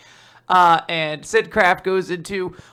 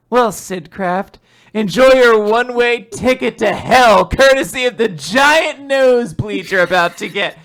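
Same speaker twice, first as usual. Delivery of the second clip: villain gloat; 5 semitones higher, 7 dB louder than usual. Acted. Villain's triumphant threat.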